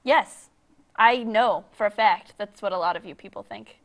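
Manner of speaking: normal talking voice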